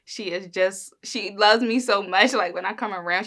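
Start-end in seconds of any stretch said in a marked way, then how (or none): none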